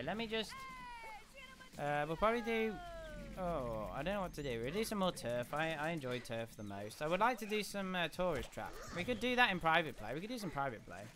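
usual speaking voice

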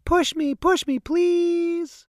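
put-on a small girl eagerly begging for a push; little girl begging. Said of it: "said Charlie".

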